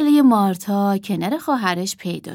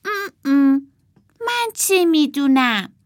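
thumb in mouth; 6 semitones higher, 3 dB louder than usual. A narrator's reading. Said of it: "شستشو کرد توی دهانش و گفت".

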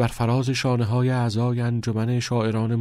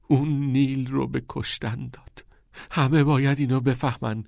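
plain reading voice

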